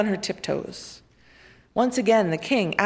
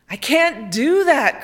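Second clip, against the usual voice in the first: louder, slow wailing refusal; protesting cry; 5 semitones higher, 5 dB louder, slower than usual. A narrator's reading. The king cries out refusing to give his things away. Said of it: "cried the king".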